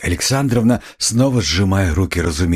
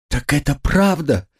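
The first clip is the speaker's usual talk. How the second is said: high-pitched, shocked question; crying out; 10 semitones higher than usual. A narrator's reading. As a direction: cry it out in a high motherly voice. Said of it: "вскричала Пульхерия Александровна".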